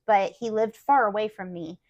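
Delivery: ordinary delivery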